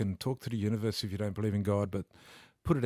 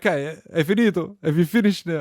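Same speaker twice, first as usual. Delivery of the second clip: put-on much higher, louder Italian-accented mum voice; 8 semitones higher, 9 dB louder than usual. Mum calling from Italy to congratulate him, in an Italian accent. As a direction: gush like an excited Italian mother on the phone. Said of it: "That's why they put the accent on".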